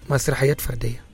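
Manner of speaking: usual speaking voice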